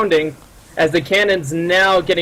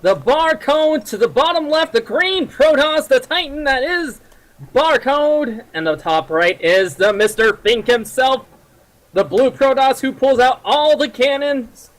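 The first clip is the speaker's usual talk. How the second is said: put-on old-timey radio announcer, pitched up; 1930s announcer voice; 6 semitones higher than usual. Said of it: "nineteen thirties announcer voice".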